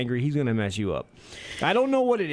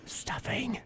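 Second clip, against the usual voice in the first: high, soft whisper; mock Walken whispering about stuffing; 10 semitones higher, 9 dB quieter than usual. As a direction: hush the word to nothing. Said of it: "I lo-, I love".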